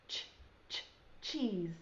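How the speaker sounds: ch, ch, chick with the ch sound split off and repeated; segmented ch sound. Shown as a demonstration. Teacher sounding out the first sound for young kids. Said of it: "They both start with the C H sound".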